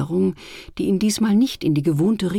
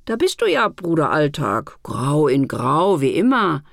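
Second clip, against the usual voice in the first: mocking pity; 3 semitones lower, 4 dB louder than usual. A narrator's reading. Festive days greet gray Alltag with mild mockery. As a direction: tease him gently, half pitying. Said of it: "Ein wenig Spott".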